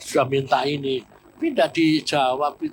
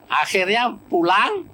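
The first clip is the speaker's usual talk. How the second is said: ordinary delivery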